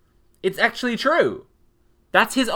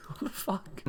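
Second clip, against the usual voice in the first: breathless whisper, much softer; soft unvoiced what the fuck; whispered disbelief; 13 dB quieter than usual. Real, not acted.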